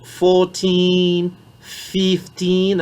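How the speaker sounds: put-on fourteen and fifteen with a long, clear teen at the end; stressed teen ending. Shown as a demonstration. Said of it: "ressortir les in là de la fin". (bien appuyer le teen de fourteen, fifteen)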